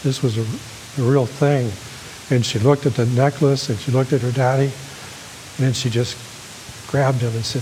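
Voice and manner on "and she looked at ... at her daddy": ordinary talk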